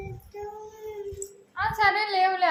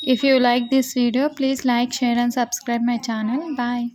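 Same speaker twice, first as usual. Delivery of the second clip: slow, louder sign-off for the channel; vlog outro; 8 dB louder, much slower than usual. Acted. Vlogger asking viewers to like and subscribe, slow outro. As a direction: spell it out slowly to viewers.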